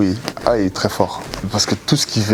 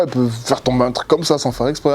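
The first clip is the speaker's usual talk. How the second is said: normal talking voice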